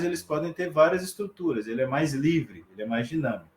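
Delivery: usual speaking voice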